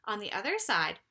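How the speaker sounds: usual speaking voice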